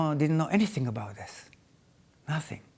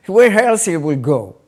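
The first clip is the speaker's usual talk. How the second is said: louder, laughing through the question; 4 semitones higher, 11 dB louder than usual. Real, not acted. Telling mother where the father went, laughing loud. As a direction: laugh it off as obvious.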